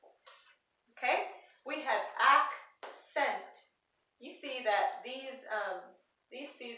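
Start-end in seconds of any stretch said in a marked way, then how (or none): 0.0-0.5 "s" as a bare hissed consonant; soft C sound. Shown as a demonstration. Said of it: "the second one says". 2.2-3.6 "accent" slowly split as ack-sent; sounded-out word. Shown as a demonstration. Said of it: "the first C says k".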